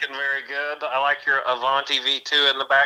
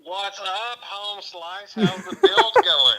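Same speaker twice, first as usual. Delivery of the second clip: phone speaker; 6 semitones higher, much slower than usual. Real, not acted. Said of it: "I got the phone next to the, uh, the microphone".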